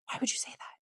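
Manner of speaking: mock-shocked whisper to her kids. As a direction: hush it out as a playfully scandalized mom.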